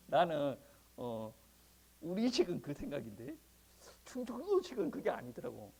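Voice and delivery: usual speaking voice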